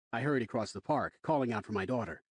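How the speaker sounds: usual speaking voice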